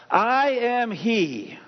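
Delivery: each word stretched out, very slow; slow solemn answer. Acted. Pastor to the congregation, very slow and solemn. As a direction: let God's answer land slowly and solemnly.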